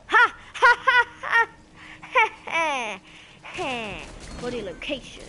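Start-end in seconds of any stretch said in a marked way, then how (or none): 0.1-4.0 loud, high ha-ha cackle tapering off; gloating laugh. Real, not acted. Gamer gloating over a kill, loud triumphant laugh. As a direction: crow with laughter, then ease down.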